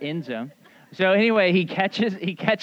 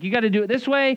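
loud, raised, imitated insistence; mock arguing; 7 semitones higher, 7 dB louder, faster than usual. Martha argues with Jesus about doing things her way, insistent. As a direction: press the demand high and hard. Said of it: "basically argues with Jesus".

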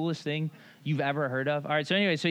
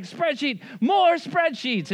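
high, drawn-out giddy cry, much higher and louder; giddy excitement; 9 semitones higher, 5 dB louder, much slower than usual. Retold or imitated. Executive pastor gushing over more spreadsheets, giddy high cry. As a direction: gush like a kid at fireworks.

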